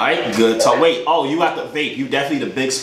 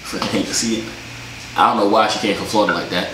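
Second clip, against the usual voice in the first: usual speaking voice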